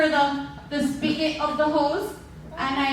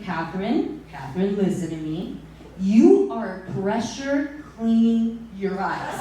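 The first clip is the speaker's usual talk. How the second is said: calm paramedic; 6 semitones lower than usual. Retold or imitated. Paramedic voiced calmly.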